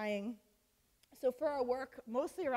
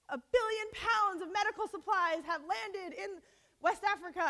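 high, louder mock newsreader; news announcer voice; 8 semitones higher, 5 dB louder than usual. Mocking the TV news while she sat on the front lines, mock announcer voice. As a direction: play the TV news anchor announcing aid with mock pomp. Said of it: "on the news, you're like".

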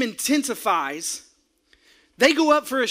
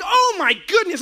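exasperated cry; 8 semitones higher, 4 dB louder than usual. Retold or imitated. Outraged at ref.

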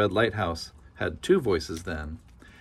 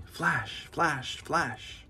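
softer, higher blinking flashes; 5 semitones higher, 6 dB quieter, much slower than usual. A narrator's reading. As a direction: say it like a steady blinking light. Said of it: "the Little Red Lighthouse spoke out plainly".

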